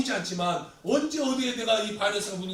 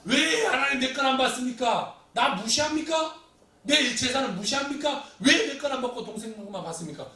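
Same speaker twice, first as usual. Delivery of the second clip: loud, high-pitched angry challenge; acted outrage, voice raised in demand; confrontational protest; 5 semitones higher, 5 dB louder than usual. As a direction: argue indignantly with God. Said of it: "막 하나님께 따지고 나와요".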